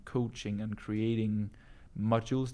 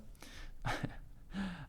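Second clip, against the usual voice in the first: breathy laugh; 8 semitones higher, 10 dB quieter than usual. Real, not acted. Laughs at subscriber count.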